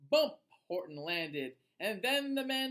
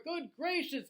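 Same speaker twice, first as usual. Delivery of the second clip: gasp; 6 semitones higher, 3 dB louder than usual. Acted. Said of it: "gasped Maisie".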